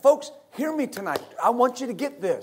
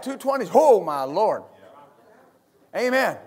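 usual speaking voice

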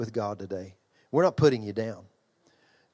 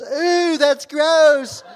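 much higher, louder squeal of disgust; grossed-out wife; 11 dB louder, much slower than usual. Retold or imitated. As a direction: recoil at the dangling toe. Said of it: "then she goes".

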